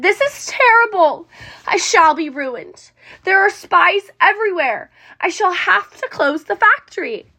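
high, loud, despairing cry; shouting in despair. A narrator's reading. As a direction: wail it like a ruined man tearing his beard. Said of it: "tore his beard and shouted".

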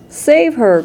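high plea for help; distressed cry. A narrator's reading. Mother begs for her daughter, high distressed cry. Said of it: "cried Missus Beauregard".

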